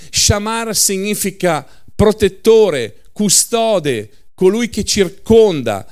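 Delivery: fervent sermon climax; preaching at full force. Acted. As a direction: drive it home from the pulpit. Preaching rebuttal.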